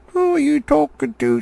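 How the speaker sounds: high, loud troll challenge; squeaky angry troll. Acted. William the troll demanding who Bert means. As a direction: shriek the question high. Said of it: "said William".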